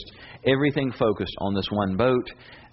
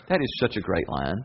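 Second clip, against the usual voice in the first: ordinary delivery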